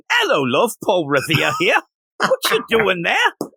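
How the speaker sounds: high, hammed-up British redcoat; British accent impression. Podcast host mocks a comic's British redcoats, British accent. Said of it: "I loved their British accents".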